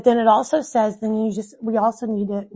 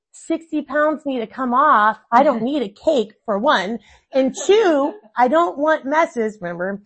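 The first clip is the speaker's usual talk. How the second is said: exasperated mom; 4 semitones higher, 6 dB louder, slower than usual. Retold or imitated. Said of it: "I'm like".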